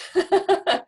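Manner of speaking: a loud, high burst of laughter; laughing at the idea that just clicked; real laugh. Real, not acted. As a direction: break into a bright laugh. Laughs at her own realisation.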